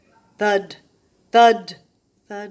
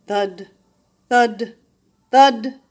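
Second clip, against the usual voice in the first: plain reading voice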